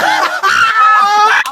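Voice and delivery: high laughing squeal; shrieking laugh. Real, not acted. Recalling the fight to a friend, high shrieking laughter. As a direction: stretch it out in a loud laughing shriek.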